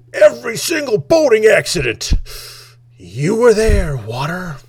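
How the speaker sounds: high shouted indictment that drops away on the last word; mock furious yell. Comedian blaming water for boating accidents, hammed-up yelling. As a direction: rant at a prop as if it were guilty. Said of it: "yelling at his water bottle".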